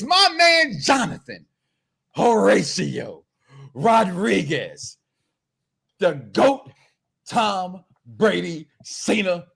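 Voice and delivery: slow announcer roll-call, each name set apart; mock grand introduction. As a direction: stretch every name out, pausing between them.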